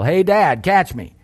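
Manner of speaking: high, loud call from above; child yelling. Retold or imitated. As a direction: shout it down from a cliff like an excited boy. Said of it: "I heard a voice from above me yell".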